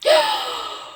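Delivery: sharp, high gasp of surprise; startled gasp. Real, not acted. Player startled by a grumpy spirit, sharp gasp.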